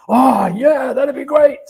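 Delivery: loud, high-pitched hammed-up Aussie enthusiasm; Australian bloke. Storyteller mocks his own clueless reaction, hammed-up Australian bloke. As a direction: play an over-keen Australian bloke, booming and high. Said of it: "the Australian bloke would go".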